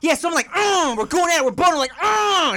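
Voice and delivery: hammed-up yelled storytelling with moans; mock screaming. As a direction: blurt it out high for the whole bar. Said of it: "He's, like, screaming this disgusting story".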